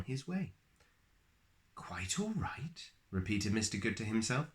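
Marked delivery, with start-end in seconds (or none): 1.8-2.9 stunned, whispered disbelief; whispering. A narrator's reading. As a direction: breathe it in wonder.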